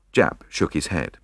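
usual speaking voice

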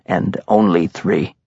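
ordinary delivery, nothing marked

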